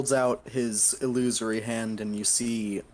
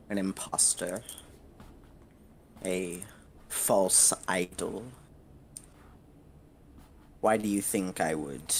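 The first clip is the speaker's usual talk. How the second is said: haughty devil lord; 3 semitones lower, 4 dB quieter than usual. Acted. Devil lord answering a petitioner about an imposter, haughty and measured. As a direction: let each phrase sink low and quiet, unhurried.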